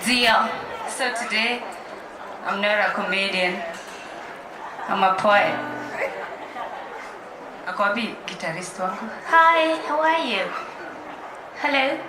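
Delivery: ordinary delivery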